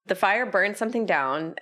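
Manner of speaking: usual speaking voice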